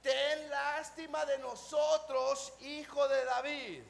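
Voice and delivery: loud, drawn-out plea; slow, loud call for pity. Acted. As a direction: cry it out slowly to someone passing by. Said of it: "dando voces y diciendo".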